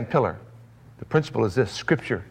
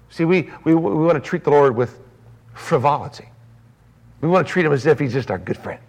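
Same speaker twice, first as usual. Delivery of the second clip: ordinary delivery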